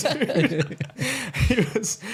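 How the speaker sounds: high loud laughter spilling into words; laughing at his buddy's reaction; laughing burst. Real, not acted. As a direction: crack up while trying to go on. Laughing at own story.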